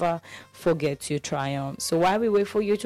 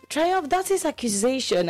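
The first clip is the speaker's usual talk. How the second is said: mock outrage; 9 semitones higher than usual. Real, not acted. Listener says she forgot him.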